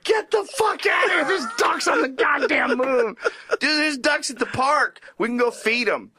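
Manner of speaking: high shouted pretend outrage; imagined moon visitor shouting; mock disbelief. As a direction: yell about moon ducks in mock shock. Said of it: "you'd be like".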